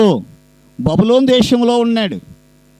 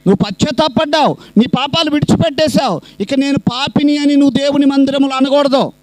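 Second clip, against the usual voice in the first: raised, fast preaching push; fiery preaching; 4 semitones higher than usual. Acted. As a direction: drive the congregation home at the peak. Preaching climax at full force. (ఉద్వేగంగా ప్రసంగం)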